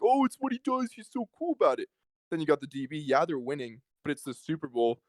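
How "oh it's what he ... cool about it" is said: high, gushing mock fan voice. Mocking fans who praise Tyreek Hill, high and gushing. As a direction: parody the adoring fans, pitch way up. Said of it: "and they're like".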